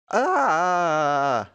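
loud, long wailing scream, falling in pitch. Real, not acted. Gamer after the gravel scare, long scream. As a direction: cry out high and let it slide down.

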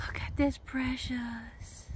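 soft, slow whisper. Real, not acted. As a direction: whisper so you don't startle it. Hushed near the baby animal.

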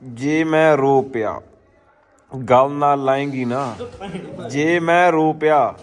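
slow, drawn-out recital of a sad poem; poem read aloud slowly with feeling; dramatic poem reading. Acted. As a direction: read each line slowly, like a heartfelt verse.

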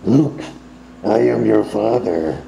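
slow, low masked Vader voice; Darth Vader. Retold or imitated. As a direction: do Vader's heavy line through the mask. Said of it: "I feel like I'm Darth Vader".

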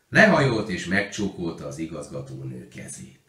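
ordinary delivery

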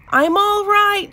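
high, loud, sustained call. A narrator's reading. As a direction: call out to reassure the others. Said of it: "she called".

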